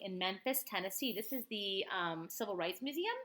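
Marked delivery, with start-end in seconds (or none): none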